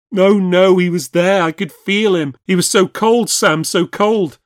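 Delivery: high, loud, terrified protest; frantic insistence. A narrator's reading. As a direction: insist in panic, refusing comfort.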